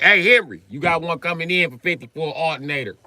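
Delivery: loud, high call across the yard; mock Russian calling out to a coworker. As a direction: shout to Henry at the gate.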